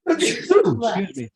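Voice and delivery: sudden sneeze mid-sentence; a real sneeze, then excuse me; sneezing. Real, not acted. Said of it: "Sorry for the sneeze".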